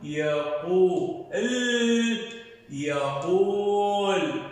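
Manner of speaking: very slow drawn-out يقول; slow syllable reading. Shown as a demonstration. Teacher to pupils, sounding out يقول slowly. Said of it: "شو يقول؟".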